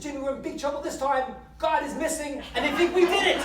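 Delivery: high, loud, urgent confession; panicked boy. Acted. Billy telling his brother they are in big trouble, panicked. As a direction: build to a frantic high punchline.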